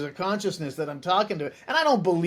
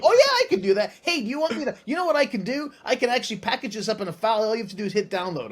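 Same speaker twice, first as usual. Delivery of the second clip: much higher, bright overeager AI voice speeding up; eager chatbot; 5 semitones higher, 3 dB louder, faster than usual. Retold or imitated. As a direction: play the overconfident assistant selling itself.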